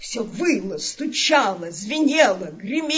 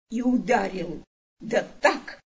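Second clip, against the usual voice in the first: ordinary delivery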